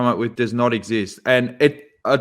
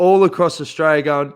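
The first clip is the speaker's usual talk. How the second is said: normal talking voice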